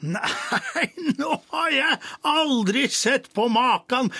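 can barely speak for laughing; king laughing hard at the joke. A narrator's reading. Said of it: "sa kongen og lo".

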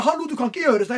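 retelling pushing back at police, voice climbing. Retold or imitated. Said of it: "Jeg begynner å heve stemmen min".

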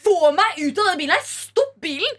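high, urgent retold shouting; retold scream. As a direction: act out crying out to be let out. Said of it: "Jeg skriker".

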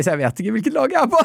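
high, quick, laugh-squeezed confession; giggling through the words; breaking into laughter. Real, not acted. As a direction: lose it while finishing the sentence. Laughing at own dilemma.

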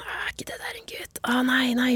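put-on gossiping old ladies' whisper; whispering like old ladies gossiping. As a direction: hiss it as gossip behind her back. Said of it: "begynner å hviske".

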